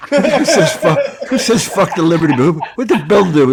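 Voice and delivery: cracking up while asking; laughing. Real, not acted. Comedian laughing while quoting Burr's rant, real laughter.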